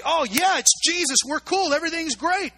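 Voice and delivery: loud, high, carefree delight; mock cheerful disciples. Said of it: "you would think they'd say".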